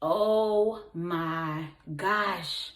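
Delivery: ordinary delivery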